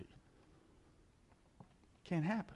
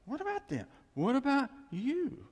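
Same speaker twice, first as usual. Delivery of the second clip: much higher, rising and pressing; excited high challenge; 10 semitones higher, 4 dB louder than usual. Acted. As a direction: throw the question back at them with fire. Preacher turning the question excitedly.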